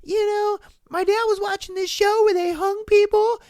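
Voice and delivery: put-on high, goofy kid voice; kid buddy voice. Kid telling his buddies about a hanging show, high and goofy. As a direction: play the excited neighborhood kid. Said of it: "you're just like".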